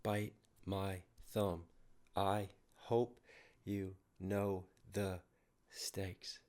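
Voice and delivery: very soft, slow, spaced-out syllables; slow syllable counting. Shown as a demonstration. Reactor counting out the rapper's meter, slow and hushed. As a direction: recite it slowly under your breath, pausing.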